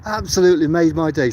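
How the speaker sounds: high, delighted greeting; excited. Real, not acted. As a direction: gush with real joy. Thrilled greeting to viewers by name.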